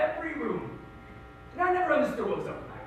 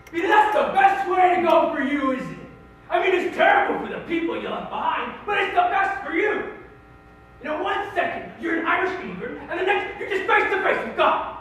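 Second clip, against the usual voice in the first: ranting shout; 7 semitones higher, 8 dB louder than usual. Real, not acted. Ranting comedic climax.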